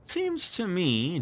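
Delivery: lightly voiced John, slow growl; a hint of a grumbling boy. A narrator's reading. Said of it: "growled John".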